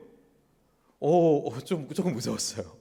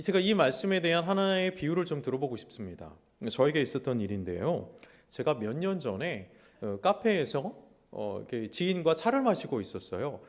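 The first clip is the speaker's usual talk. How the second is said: normal talking voice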